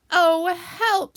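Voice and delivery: high, loud wail for help; loud storybook distress; alarmed cry. Acted. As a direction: cry out as you get wedged. Pooh stuck in the hole.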